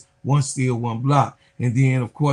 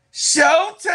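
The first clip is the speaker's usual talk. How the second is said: high, loud hype call of Showtime; hype shout; 11 semitones higher, 7 dB louder than usual. Real, not acted. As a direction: shout it like a crowd-pleasing announcer. Hyping Olivia Miles.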